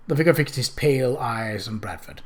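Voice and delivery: usual speaking voice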